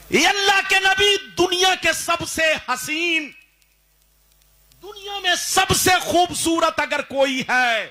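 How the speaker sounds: preacher lifting the voice in praise; exalted preaching. Acted. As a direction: soar up on the praise. Preacher exalts Prophet Yusuf.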